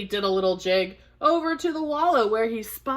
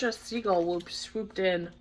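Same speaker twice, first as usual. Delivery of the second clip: plain reading voice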